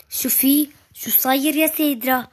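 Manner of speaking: ordinary delivery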